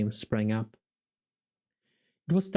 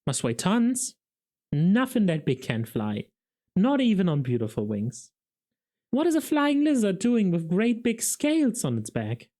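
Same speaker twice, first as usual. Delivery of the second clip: jeering; 6 semitones higher than usual. A narrator's reading. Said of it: "they jeered".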